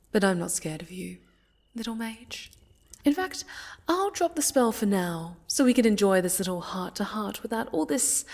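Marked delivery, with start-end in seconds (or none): none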